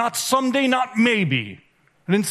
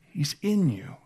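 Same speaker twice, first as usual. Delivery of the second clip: ordinary delivery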